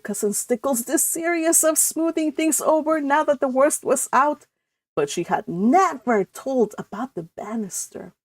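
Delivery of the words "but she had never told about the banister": ordinary delivery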